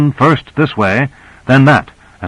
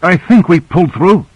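gasped; 7 semitones higher than usual. A narrator's reading. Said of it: "gasped Owens".

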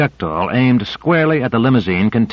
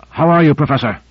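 ordinary delivery, nothing marked

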